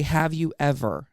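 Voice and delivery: ordinary delivery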